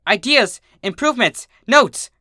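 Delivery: an inventor overjoyed at new ideas; excited scream. Acted. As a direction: burst out with manic excitement. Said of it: "jumped up from her seat and screamed".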